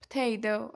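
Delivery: Potato with the last T flapped to a D; teacher's American-style model of Potato; native-like potato. Shown as a demonstration. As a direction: say Potato as p'tay-doh. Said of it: "بگید potato".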